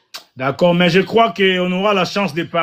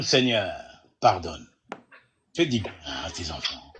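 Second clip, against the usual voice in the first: prayer; 8 semitones lower than usual. Real, not acted. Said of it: "Je vais juste prier pour tout le monde".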